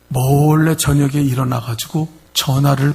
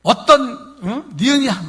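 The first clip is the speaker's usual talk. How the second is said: high, loud, accusing questions; demanding; 8 semitones higher, 5 dB louder than usual. Retold or imitated. 바람난 남편을 다그치는 아내의 날카로운 추궁. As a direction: confront him like a wife who caught him. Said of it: "막 따지니까요".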